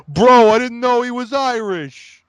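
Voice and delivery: high, loud, slow mock voice; mocking Russo quote. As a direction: play dumb, loud and drawn out. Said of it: "He's like".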